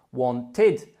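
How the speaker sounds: teacher's model of "wanted" ending in id. Shown as a demonstration. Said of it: "The id sound is put on".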